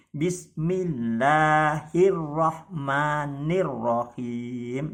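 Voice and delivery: Bismillahirrahmanirrahim slow, doubles held and pressed; held doubled letters. Shown as a demonstration. Teacher reciting for students, doubles held. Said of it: "ditahan sejenak dan agak ditekan".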